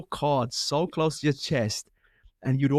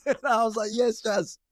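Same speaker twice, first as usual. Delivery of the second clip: laughing; 10 semitones higher than usual. Real, not acted. Laughing retelling Jazz's game move.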